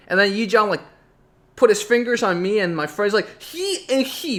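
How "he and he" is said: high-pitched imitation of a child accusing; limited-English boy. Boy with little English blames two classmates, high and insistent. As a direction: squeal the few words like an accusing kid. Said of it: "he couldn't really speak English".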